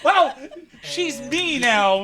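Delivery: slow, loud words squeezed out through laughter; barely able to speak for laughing; real laughter. Real, not acted. GM laughing at accent mix-up.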